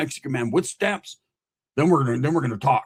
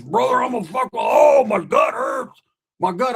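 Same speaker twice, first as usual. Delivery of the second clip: high, loud mock howl; hammed-up agonized shouting; pained yelling; 9 semitones higher, 5 dB louder than usual. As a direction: imitate a patient hollering in pain. Friend thrashing in pain, pulling tubes.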